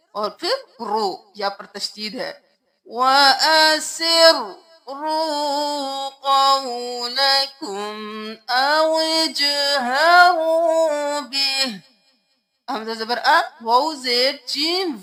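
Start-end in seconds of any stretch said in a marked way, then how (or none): 2.9-11.8 melodic Quran recitation. Shown as a demonstration. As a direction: chant each word long and ringing. (تجوید کے ساتھ ترتیل)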